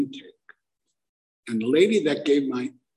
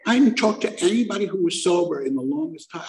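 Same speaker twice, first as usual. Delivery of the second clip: ordinary talk, nothing marked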